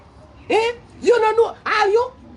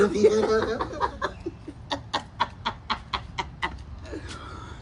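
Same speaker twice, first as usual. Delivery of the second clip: a long, soft, breathless wheeze of laughter; wheezing laugh; 7 semitones lower, 8 dB quieter than usual. Real, not acted. Cracking up at the fist joke, in a breathless wheeze.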